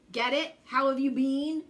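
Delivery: ordinary delivery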